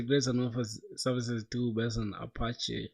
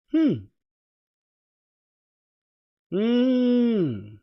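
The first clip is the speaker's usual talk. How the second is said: high skeptical hum; 10 semitones higher, 8 dB louder than usual. Real, not acted. Reacting to announcement.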